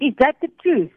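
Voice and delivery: high-pitched mock demand; retold yelling. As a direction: push the question as an imitated interrogator, half-yelled. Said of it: "They said, yelling again".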